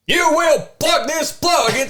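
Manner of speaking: barked high, slow drill sergeant orders. Pretend. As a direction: bark it like a boot camp instructor. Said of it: "You do like a drill sergeant?".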